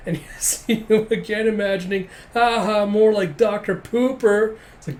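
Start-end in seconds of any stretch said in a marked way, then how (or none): 2.3-4.6 loud, high taunt, drawn out; hammed-up ridicule, stretching the silly nickname; mock jeering. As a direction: jeer like a playground bully. Imagined colleagues mock the doctor.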